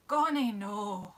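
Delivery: mock Scottish accent; Scottish accent with a laugh. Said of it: "instead of saying why".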